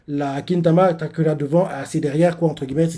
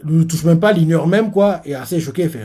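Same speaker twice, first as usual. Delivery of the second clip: ordinary delivery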